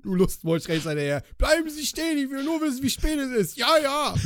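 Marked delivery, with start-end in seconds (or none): 1.4-4.2 high mocking voice; hammed-up imitation; mock creep. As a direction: tease him by playing the stalker. Mocking friend's chase story.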